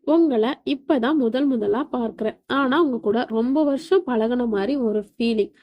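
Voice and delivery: ordinary delivery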